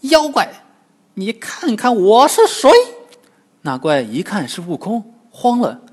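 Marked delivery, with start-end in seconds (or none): 0.1-2.7 high, loud battle cry; Monkey King yells at the demon as he reveals himself; thundering shout. Acted. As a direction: roar it to unmask yourself. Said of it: "喝道".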